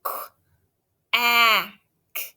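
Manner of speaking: qu, ah, k split into sounds; sounding out. Shown as a demonstration. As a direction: tap out Qu-, ah, k. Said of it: "Help me do it".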